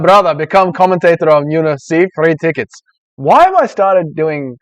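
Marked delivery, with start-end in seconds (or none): 0.0-2.7 put-on Dagestani accent, pitched up and louder; mock Dagestani accent. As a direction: read it out like a Dagestani fight fan. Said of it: "a Dagestani accent for you".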